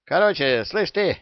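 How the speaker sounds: high, loud cocky parrot snap; angry parrot. A narrator's reading. Parrot snapping at the pirate, angry and high. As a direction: bark it like a cheeky bird. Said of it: "сердито сказал Яга Сильверу".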